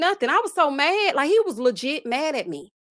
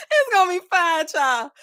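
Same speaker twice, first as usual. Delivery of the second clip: high, louder laugh into the words; 7 semitones higher, 5 dB louder than usual. Real, not acted. Host laughing about her microwave-hating grandma, high and loud. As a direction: laugh through the reassurance.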